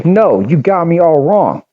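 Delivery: put-on high, protesting Jersey accent; a lightly put-on Jersey accent; thick Jersey accent. A narrator's reading. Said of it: "a thick Jersey accent".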